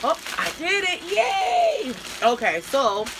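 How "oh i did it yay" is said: high squeal of triumph on Yay; excited cheer. Real, not acted. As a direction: cheer like you finally won. Finally opens the package.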